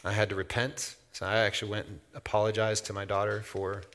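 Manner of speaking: normal talking voice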